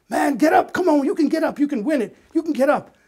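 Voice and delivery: loud, high shout across the field; yelling encouragement. Retold or imitated. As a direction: yell to a runner to get up and win. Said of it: "He's still yelling to this kid".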